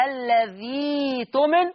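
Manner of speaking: "الذي تؤمن" with a long ee and no hamza; Warsh joined reading. Shown as a demonstration. Teacher reading it joined as Warsh, "الذي تؤمن" on a held ee. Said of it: "لما أجي نقرأها بورشة أقول".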